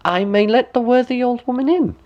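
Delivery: put-on high girlish voice. A narrator's reading.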